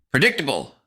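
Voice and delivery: high, loud blurt; sudden loud cry; shouting. A narrator's reading. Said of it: "I shouted at long last".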